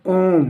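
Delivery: ओम with the O held very long; ओम as one sustained, drawn-out syllable; prolonged pluta vowel. Shown as a demonstration. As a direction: stretch out the vowel in ओम. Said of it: "तीन गुना ताकत से ज़्यादा बोलना पड़ेगा".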